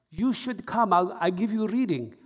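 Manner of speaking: a lightly put-on Eastern European accent; thick Eastern European accent. Eastern European accent as she invites the narrator to a reading. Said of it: "she had this thick, like, uh, accent".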